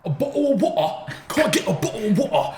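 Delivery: high, exaggerated British "bottle of water"; put-on British accent with half-swallowed words; mock British accent. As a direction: widen the mouth for a hammed-up British accent. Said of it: "making yourself wider".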